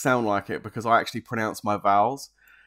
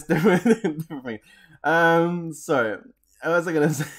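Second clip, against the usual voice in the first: ordinary talk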